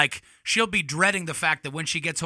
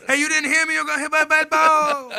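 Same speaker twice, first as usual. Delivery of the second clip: high, loud, laughing holler; mock yelled warning; 11 semitones higher, 6 dB louder than usual. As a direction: yell it with syllables starting to blur. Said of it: "you lose syllables as it goes".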